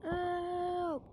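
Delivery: high, drawn-out wailing; slow, high-pitched play-acted despair; mock falling wail. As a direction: stretch the words out slowly like a long fall. Acted fall from the sky.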